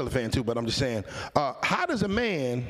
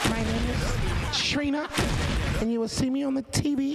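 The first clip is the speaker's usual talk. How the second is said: imitating [name]; 5 semitones higher than usual. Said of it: "Shouts out to [name]".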